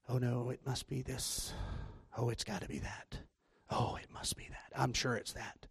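soft, low, half-whispered worrying; acted anxious muttering to oneself; hushed fretting. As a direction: fret under your breath, sinking into a whisper. Acting out anxious guessing at a word.

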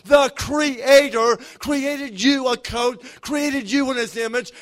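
loud, rising preacher's cadence, driving each line home; preaching at full force. Acted. Pastor urging the standing church to receive, preached full force. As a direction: pour it out over the congregation like a revival call.

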